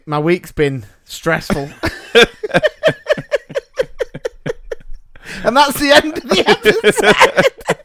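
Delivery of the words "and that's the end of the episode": high, loud laughing sign-off. Real, not acted. Host ending the episode, really laughing through it. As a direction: squeeze the words out through real laughter.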